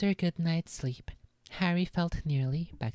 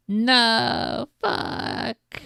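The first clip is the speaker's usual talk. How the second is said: louder, higher frustrated outburst; real annoyance at more reading; frustrated curse; 9 semitones higher, 7 dB louder than usual. Real, not acted. Annoyed the chapter continues.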